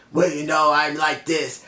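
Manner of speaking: light imitation of a monotone promo; Eric Young impression. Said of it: "having a monotone voice".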